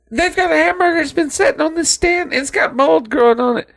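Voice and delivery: high, quickened imitation of a sister; sister's voice. Sister complaining about an old hamburger, high mock voice. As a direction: put on a high, hurried sister voice. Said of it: "And she said".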